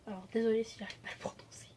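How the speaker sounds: quick, low whispered apology; hushed, rapid sorry to the viewers; apologetic aside. Real, not acted. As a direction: whisper it hurriedly under your breath. Apologetic aside about her pronunciation.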